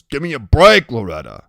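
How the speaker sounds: loud burst, dropping on the name; exasperated brush-off with a scowl. A narrator's reading. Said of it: "Iggy scowls and he goes".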